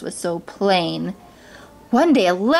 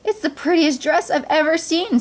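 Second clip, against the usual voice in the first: high, thrilled admiration; delighted Cinderella; 8 semitones higher than usual. A narrator's reading. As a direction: marvel with girlish delight. Cinderella delights in her dress.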